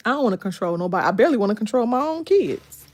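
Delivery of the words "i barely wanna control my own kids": ordinary talk, nothing marked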